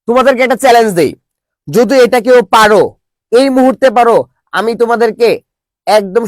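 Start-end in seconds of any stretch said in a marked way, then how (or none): none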